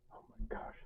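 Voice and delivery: breathy whispered oh my gosh; whispered aside. Real, not acted. Vlogger reacting to the label, whispered aside. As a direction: drop to a whisper in mock shock.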